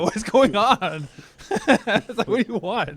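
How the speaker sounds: mock-frightened plea to staring kangaroos; a shaky, laughing guy surrounded by kangaroos; nervous, laughing tourist voice. Guy circled by kangaroos, mock-scared and laughing. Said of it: "You're like".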